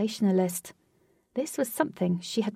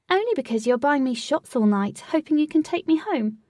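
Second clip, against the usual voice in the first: higher, louder comeback; sharp retort; 6 semitones higher, 5 dB louder than usual. A narrator's reading. Butterfly answering the man buying her shots, indignant. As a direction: voice lifts in indignant protest.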